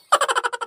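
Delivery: a short high burst of laughter; loud laugh. Acted. Brother-in-law laughs after his teasing song, loud. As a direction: laugh at your own joke.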